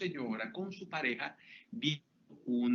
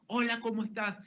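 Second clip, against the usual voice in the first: cheerful greeting; 7 semitones higher, 4 dB louder than usual. Retold or imitated.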